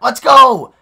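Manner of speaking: loud triumphant yell; excited shout. Real, not acted. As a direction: cheer it out at full voice. Celebrating a made shot.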